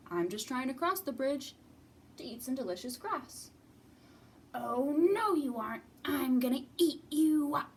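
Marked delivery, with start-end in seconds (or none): 4.5-7.7 loud threatening troll; hammed-up hungry troll; mean troll. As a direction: threaten to gobble the goat. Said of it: "a mean troll".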